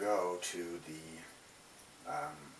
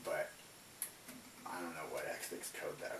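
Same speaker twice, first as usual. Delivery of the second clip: ordinary delivery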